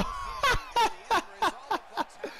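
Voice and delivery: high laugh. Real, not acted. After the big hit.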